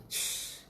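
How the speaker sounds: imitated air escaping from a tyre; soft voiceless hiss, like a tyre going down; deflating tyre hiss. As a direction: let out a quick "shh" of air. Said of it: "kayak ban kempes".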